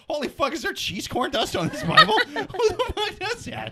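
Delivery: high incredulous squeal, laughing through; shocked and laughing, way up high; outraged juror. Retold or imitated. Acted courtroom juror outrage.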